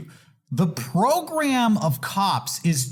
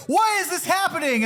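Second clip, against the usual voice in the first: high, bewildered plea; mock panic; 11 semitones higher than usual. Being arrested for watching Cops, mock panicked and high. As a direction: act helpless and confused.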